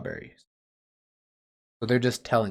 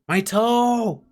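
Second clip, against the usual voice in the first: much higher, loud cry of pain; pained shout; 10 semitones higher, 3 dB louder than usual. Shown as a demonstration. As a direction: yell it as if your toe throbs. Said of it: "You'd be shouting 'cause your toe hurts".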